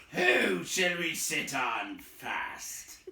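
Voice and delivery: lightly voiced troll, slow. A narrator's reading. Troll asking who to sit on, slow. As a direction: drawl it out slowly. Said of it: "said the voice".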